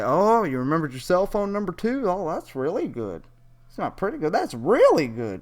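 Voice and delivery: high, sarcastic fake praise; mock praise. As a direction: gush over it as if it's impressive. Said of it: "I was gonna say".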